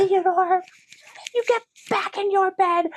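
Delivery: high-pitched mock parental yell; retold yell. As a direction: re-enact yelling a child's whole name. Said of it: "I yelled, like, his whole name".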